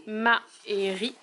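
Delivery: 1 syllables spelled out slowly. Shown as a demonstration. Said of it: "fallait qu'il colorie tous les s".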